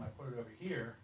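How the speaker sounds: very faint, distant from the microphone; off-mic. Shown as a demonstration. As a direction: move away from the mic while talking. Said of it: "it sounds like that".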